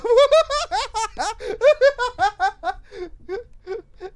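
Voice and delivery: loud high shriek of laughter; panicked scream-laugh. Real, not acted. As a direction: laugh and scream at once in fright. Scream-laugh after scare. (éclat de rire hurlé de panique)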